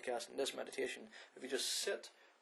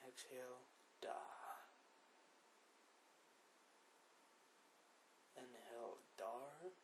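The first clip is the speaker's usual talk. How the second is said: guided meditation; 14 dB quieter than usual. Acted. Podcast host guiding listeners through breathing, soft meditation voice. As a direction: lead the breathing gently with long pauses.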